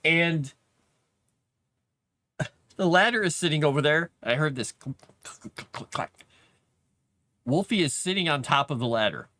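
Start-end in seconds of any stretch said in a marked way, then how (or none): 5.2-5.9 breathy unvoiced clicking noise; whispered clacking. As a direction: mimic a ladder clacking, no voice. Imitating ladder clack noise.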